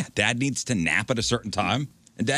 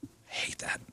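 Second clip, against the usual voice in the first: unvoiced hushed aside; whisper; 12 dB quieter than usual. Real, not acted. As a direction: breathe it out with no voice.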